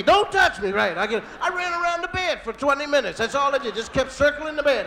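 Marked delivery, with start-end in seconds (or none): 0.0-0.7 high, loud order; wife's pained command. Retold or imitated. Wife in labor fends off her husband, high loud command. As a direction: push him away with a high cry.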